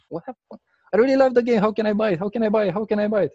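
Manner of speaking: high and loud, bursting with delight; can't contain the joy, begging to buy it; thrilled. Retold or imitated. Deaf guest telling the host she loves the game, high thrilled voice.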